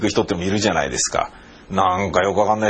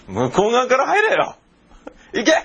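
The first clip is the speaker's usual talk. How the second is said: high shouted command; cop's loud order; 13 semitones higher than usual. Retold or imitated. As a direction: shout it like a cop shooing people away. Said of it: "大きな声でこう言いました". (警官が大声で追い払う)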